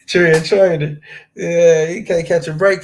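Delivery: loud laugh through the words. Real, not acted. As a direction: crack up while reading it. Laughing at the chat comment.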